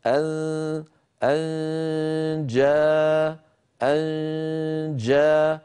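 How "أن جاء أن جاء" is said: أن جاءه very slowly, the nasal held before a firm جيم; model recitation. Shown as a demonstration. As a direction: hum the nasal of أن long, then close firmly on جاءه. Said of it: "مهيأ على مخرج الجيم من غير ضغط".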